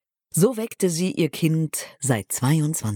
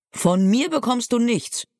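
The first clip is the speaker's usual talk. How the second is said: higher-pitched, mildly called-out rebuke; firm, raised-pitch exclamation in a narrator's reading; calling out; 8 semitones higher than usual. A narrator's reading. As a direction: call it out at the parrot, without really shouting. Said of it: "rief sie".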